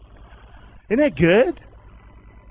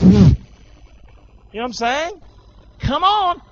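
exasperated God; 7 semitones higher, 5 dB louder than usual. Acted.

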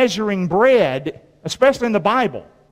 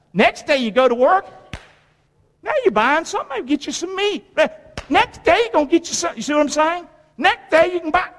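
excited; 8 semitones higher than usual. Acted. Excited imagining of better days.

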